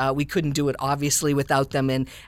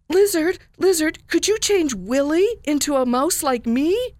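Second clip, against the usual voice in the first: pleading little mouse; 12 semitones higher, 4 dB louder than usual. Acted. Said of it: "Then suddenly he said".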